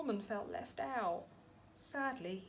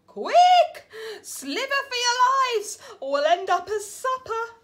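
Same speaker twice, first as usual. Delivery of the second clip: loud, much higher frantic call; panic; 11 semitones higher, 12 dB louder than usual. Acted. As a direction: shout at everyone to run. Snails flee the bird.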